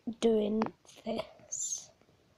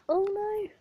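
sudden high alarmed cry; surprised; 8 semitones higher, 4 dB louder than usual. Real, not acted. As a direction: blurt it out in dismay. Phone gets stuck in cage.

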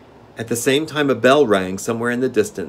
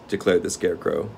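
plain reading voice

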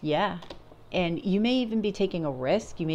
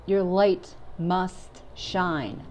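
"must" leaned on, the whole line slowed right down; slow and weighty, hit "must" hard; emphasis on must; much slower than usual. Shown as a demonstration. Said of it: "I'm emphasizing must".

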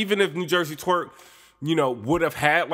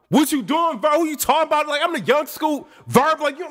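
much higher, taunting rapper voice; hyped battle rapper; 13 semitones higher than usual. Retold or imitated. Imitating rapper taunting opponent mid-battle.

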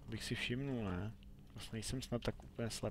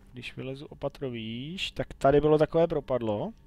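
usual speaking voice

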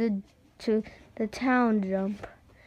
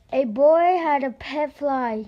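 ordinary delivery, nothing marked

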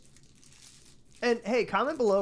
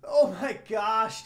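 drawn-out shout; 4 semitones higher, much slower than usual. Real, not acted. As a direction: stretch it out in shock. Spicy candy hits hard.

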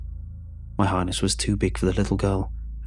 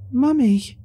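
put-on high, loud delighted cry of a little girl; a small child spotting her mother; happy child's cry; 5 dB louder than usual. A narrator's reading. Little girl sees the bathtub and calls happily, high and loud. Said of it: "she said happily".

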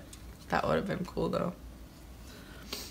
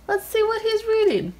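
high, louder taunting tone; teasing; 13 semitones higher, 5 dB louder than usual. Real, not acted. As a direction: taunt him playfully. Teasing with his phone.

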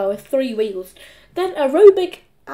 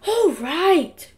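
higher, reluctant giving-in; grumbled assent; 6 semitones higher than usual. A narrator's reading. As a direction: give in with a grumble. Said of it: "grumbled Henry".

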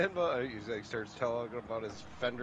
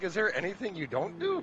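retold amazement; 7 semitones higher than usual. Quotes own amazed question.

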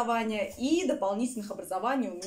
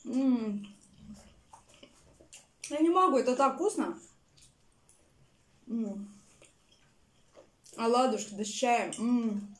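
talking while chewing; eating between words; mouth full of pancake. Real, not acted. Seen 2: Fork brings pancake into mouth at 1.9s; she chews while talking throughout.

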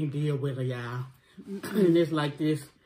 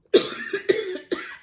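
sharp choking cough; 6 dB louder than usual. Real, not acted. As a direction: cough out the wrong pipe. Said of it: "I was choking".

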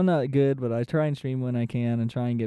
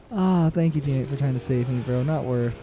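ordinary talk

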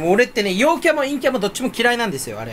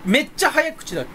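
usual speaking voice

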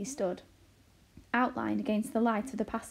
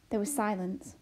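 plain reading voice

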